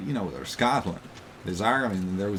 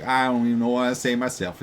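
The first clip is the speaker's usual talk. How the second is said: mock Southern accent; 3 semitones higher, 5 dB louder than usual. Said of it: "They're like".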